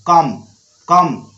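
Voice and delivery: कम repeated singly, ending on m; कम, कम, each closed on the m; correct silent B. Shown as a demonstration. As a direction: say कम with no b, then repeat after a pause. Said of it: "कम बोलना है".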